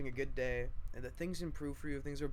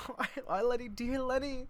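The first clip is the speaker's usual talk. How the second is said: much higher, fluttery voice; fond greeting; 12 semitones higher than usual. Acted. Meg greeting her sister Lenny, higher and fond. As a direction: coo her sister's name.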